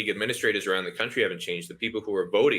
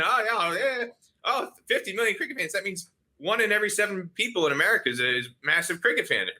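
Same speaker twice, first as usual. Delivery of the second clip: mocking; 10 semitones higher than usual. Mocking inflated fan numbers.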